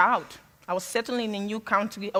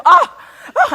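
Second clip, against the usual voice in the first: very high, loud laughing imitation of a moan; mock moan again; 13 semitones higher, 15 dB louder than usual. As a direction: camp it up, moaning through a laugh. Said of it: "would moan like".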